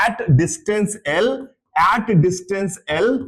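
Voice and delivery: each word laid out slowly; slow spelled-out phrasing. Shown as a demonstration. Teacher slowly saying the English phrase for students. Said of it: "अगर मैं हिंदी भाषा में बोलूं".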